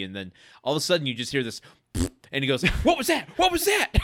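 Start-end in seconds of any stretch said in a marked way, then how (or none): 2.8-3.9 high-pitched, jittery imitation of startled dad; mock panic. Dad hearing a sudden noise, mock high-energy panic. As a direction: squeal it in exaggerated alarm. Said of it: "muttering to himself with this high energy".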